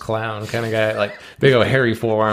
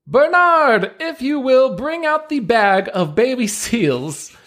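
higher pitched ringmaster patter, slow and grand; showman ringmaster; 10 semitones higher, slower than usual. Acted. Ringmaster presenting seals to the crowd, grand showman voice. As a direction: play the circus ringmaster working the crowd.

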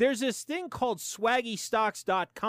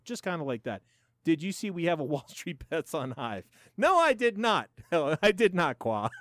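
usual speaking voice

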